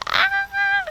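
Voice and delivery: very high delighted squeal. Real, not acted. Reader squealing at the hug, high delight. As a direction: squeal with glee.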